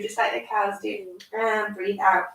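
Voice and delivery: slow, gentle yoga cueing; calm instructions for a small child; reading to a toddler. Acted. Guides a child through yoga poses.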